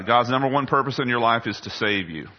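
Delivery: ordinary delivery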